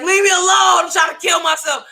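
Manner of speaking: high, loud mock-dramatic teen outburst; retold yell. Sister yells at her little brother to go away, hammed-up. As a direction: shout it high, shooing him off. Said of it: "And I was like".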